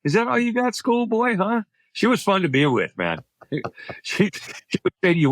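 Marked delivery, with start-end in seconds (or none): 0.0-1.6 high-pitched teasing challenge; playful taunt in a raised voice; imitating taunting ex-wife. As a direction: goad them like a sparring ex. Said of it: "she's like that. She's a little bit of".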